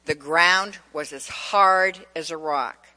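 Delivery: ordinary talk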